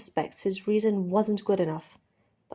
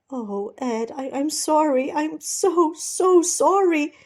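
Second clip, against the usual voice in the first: rising higher and louder to a slow cry; anguished apology; 8 semitones higher, 6 dB louder, slower than usual. Acted. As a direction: break down begging forgiveness. Steed apologising, overcome.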